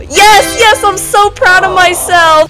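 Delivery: high, loud proud celebration; triumphant cheer. Real, not acted. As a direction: crow over your first win. Celebrates first kill.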